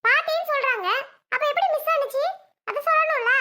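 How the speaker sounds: high-pitched indignant demand; angry accusing. Acted. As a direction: push back at him, pitch up. Quarrel over the missing chain. (அண்ணனிடம் நகையைப் பற்றி கோபமாக உயர்ந்த குரலில் கேட்கிறாள்)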